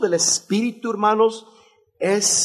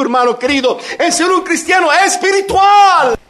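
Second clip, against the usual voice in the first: louder and higher, ending in a shout; full-force preaching; 5 semitones higher, 3 dB louder than usual. Acted. Preacher exhorting the church, building to a shout. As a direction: drive each ha muerto harder to a shouted close.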